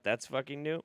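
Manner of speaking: ordinary talk, nothing marked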